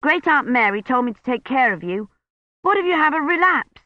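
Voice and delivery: put-on a boy pleading his case; lightly voiced worried boy; boy Colin. A narrator's reading.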